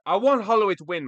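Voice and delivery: loud, pleading insistence; really mean it, push it out; emphatic plea. Real, not acted. Passionate plea for Holloway.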